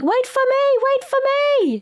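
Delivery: very high, breathless plea; high falsetto calling. Retold or imitated. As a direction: call after the boat in falsetto. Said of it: "Sugar's like".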